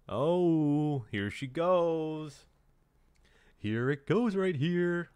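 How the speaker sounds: high, drawn-out rising excitement; excited anticipation. Real, not acted. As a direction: stretch it out as the action kicks off. Excited as the action starts.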